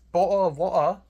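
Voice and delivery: loud exaggerated British with swallowed Ts. Shown as a demonstration. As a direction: belt out a hammed-up British "bottle of water". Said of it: "a stereotypical British accent".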